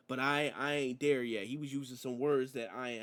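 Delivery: ordinary talk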